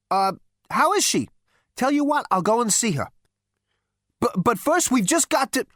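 high, hurried-sounding hesitant appeasement; flustered Zaphod. Acted. Zaphod tries to placate his ancestor, flustered and high. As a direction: stumble through placating him.

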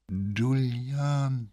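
slow, dragged-out vague voice; sluggish drawl. A narrator's reading. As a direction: drag the name out dreamily. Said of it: "in demselben träge schleppenden Tonfall".

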